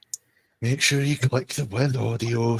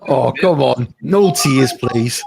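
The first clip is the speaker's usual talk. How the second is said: put-on Scouse accent; 3 semitones higher, 5 dB louder, slower than usual. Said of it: "Liverpool accent".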